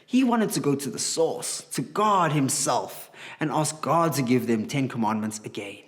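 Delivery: normal talking voice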